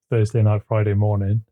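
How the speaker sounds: ordinary talk, nothing marked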